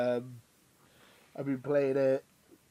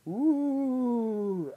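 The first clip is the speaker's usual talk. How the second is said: spooky ghost voice; 9 semitones higher, 4 dB louder than usual. Pretend. Spooky Halloween episode intro.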